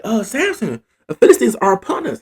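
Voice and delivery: high-pitched warning cry; mock alarm. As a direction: play a woman calling out danger. Delilah raises the alarm, acted.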